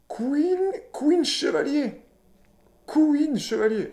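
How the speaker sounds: high, drawn-out mock fanfare; hammed-up high-pitched card name; playful exclaiming. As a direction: call it out slowly in a high, playful voice. Playful riff on a mouse card.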